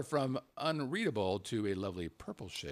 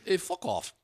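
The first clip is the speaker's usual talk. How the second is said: bad mock British accent; horrible British accent; 3 semitones higher than usual. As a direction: imitate him with a clumsy British accent. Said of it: "he has a British accent".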